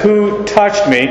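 "Who touched me?" high and slow, WHO stressed; emphatic. Acted. Christ to the people bumping shoulders, emphatic high slow WHO. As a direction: draw out a high WHO, then fall on "touched me". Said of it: "since Christ is so emphatic".

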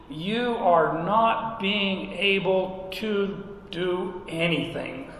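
slow, measured scripture reading; deliberate emphatic reading. Acted. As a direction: spell out the verse slowly for emphasis.

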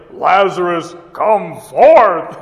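booming command to the dead man; the familiar dramatic cry, played big; mock loud call. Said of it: "but it's actually in a loud voice".

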